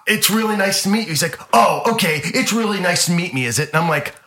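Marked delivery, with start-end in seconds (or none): 0.1-1.1 tentative politeness. Retold or imitated. Acted nervous fan, then echoed mockingly. 1.5-3.7 loud, higher mocking repeat; imitated star's sarcastic jab; sarcastic echo. Acted star mocking the fan's greeting.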